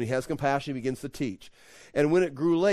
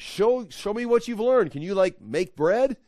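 higher, louder, slow testing questions; spaced-out, raised-pitch dare; acted challenge; 7 semitones higher, 4 dB louder than usual. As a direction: challenge a pupil to prove it, pitched up. Voicing Jesus challenging disciples.

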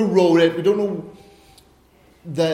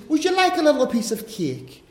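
Irish lady offering; 7 semitones higher than usual. Retold or imitated. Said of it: "the Irish lady ... she says".

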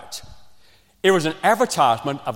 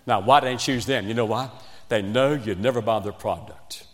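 normal talking voice

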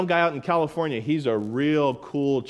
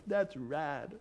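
very soft, laid-back dude voice; chill guy impression; 12 dB quieter than usual. Preacher mimicking a chill Californian, hushed laid-back voice. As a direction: murmur it like a mellow, chill dude. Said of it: "And that's how he sounds. He's like".

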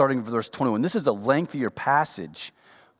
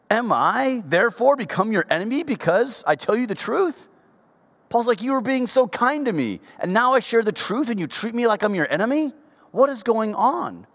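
indignant preaching; 11 semitones higher than usual. Acted. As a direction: cry it out like a wounded apostle. Preacher voicing Paul's indignant question.

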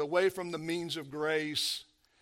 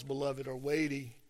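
ordinary delivery

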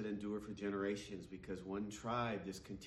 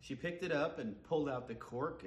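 usual speaking voice

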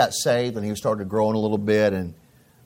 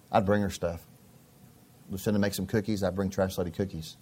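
ordinary delivery

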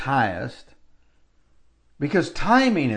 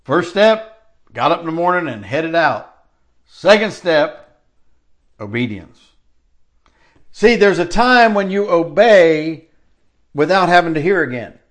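preaching at full force; 3 semitones higher, 5 dB louder than usual. Acted. Preacher hammers the steps of obedience to the church, louder. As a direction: pound the points like a pulpit preacher.

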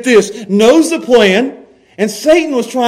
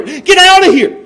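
higher, louder rebuke to Peter; hard dismissal; 11 semitones higher, 6 dB louder than usual. Retold or imitated. Jesus rebuking Peter, loud sharp dismissal. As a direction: drive him off sharply. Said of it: "he's saying that to Peter".

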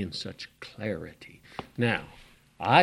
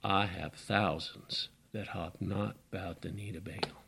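much softer, low and intimate; hushed divine voice; 4 semitones lower, 8 dB quieter than usual. Acted. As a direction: speak as the still small voice. God speaks in still voice.

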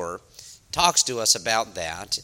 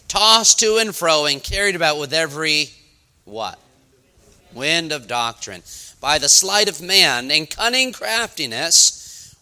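preaching scripture reading; 4 semitones higher, 7 dB louder than usual. Acted. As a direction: proclaim the verses to the church. Reads scripture aloud to the congregation.

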